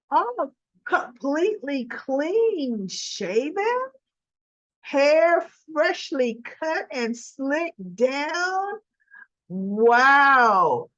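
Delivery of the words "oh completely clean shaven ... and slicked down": slow, higher, admiring gush. Real, not acted. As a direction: linger on each detail in awe. Admiring Sonny's look.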